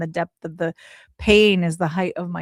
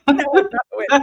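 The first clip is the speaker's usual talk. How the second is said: laughing denial; 7 semitones higher, 5 dB louder, faster than usual. Retold or imitated.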